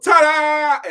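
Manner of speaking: high, loud fanfare ta-da; musical ta-da. Retold or imitated. Preacher voicing the circus music's fanfare, loud and high. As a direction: sing it out like a brass fanfare. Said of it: "the music goes".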